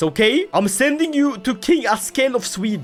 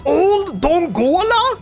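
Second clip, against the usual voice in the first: put-on higher, slow, disbelieving Old Dongola; incredulous repeat; 7 semitones higher, much slower than usual. Shown as a demonstration. Gamer echoing the town name, slow and incredulous.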